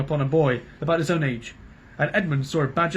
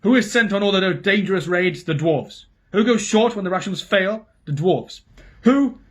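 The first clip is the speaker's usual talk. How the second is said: loud, rising dwarf rant; dwarf protests to the king; angry rant; 5 semitones higher, 5 dB louder than usual. A narrator's reading. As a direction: hammer each question in anger. Dwarf rants about his people.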